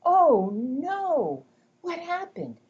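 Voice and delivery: high, loud gasp of surprise; playful hammed-up shock; mock alarm. Shown as a demonstration. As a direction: hook the child with fake alarm. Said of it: "I might go".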